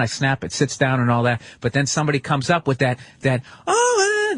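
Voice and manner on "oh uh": very high squealed "oh"; mocking a stranger gushing at a dog; excited cooing voice. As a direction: squeal like someone melting over a dog. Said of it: "that kind of voice".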